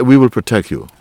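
loud, mock mafia assurance; mafia protector. Mafia promising safety to someone seeking protection, loud mock assurance. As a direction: offer protection as a forceful mobster. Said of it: "going to the mafia".

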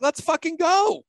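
high, loud and drawn out; eager yes. Retold or imitated. Host telling a podcast invite he's all in, loud and excited. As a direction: shout it out with pumped-up joy.

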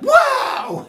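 high, loud burst of wonder; amazed wow. Retold or imitated. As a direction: gasp it out in sudden awe. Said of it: "that's how it was".

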